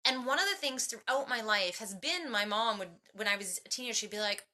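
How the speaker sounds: usual speaking voice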